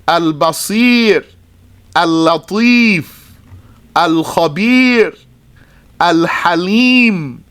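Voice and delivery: drawn-out, singsong list of Arabic names; measured recitation. Shown as a demonstration. Teacher reciting names that share this pattern, measured recitation. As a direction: recite each name separately with a rise and fall.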